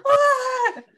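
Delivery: very high, loud shriek of disbelief; retold alarm. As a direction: squeal it high in mock terror. Retold terrified reaction while crying.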